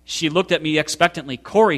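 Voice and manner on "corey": prodding. A narrator's reading. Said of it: "she prodded".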